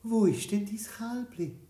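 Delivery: lightly voiced grandmother; old woman asking. A narrator's reading. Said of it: "So hat die alte Frau gefragt". (alte Frau, neugierig und etwas höher)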